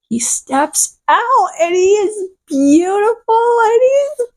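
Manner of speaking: high, slow, teary squealing; overwhelmed, half-crying adoration; swooning over the singer on stage; gushing fangirl. Real, not acted.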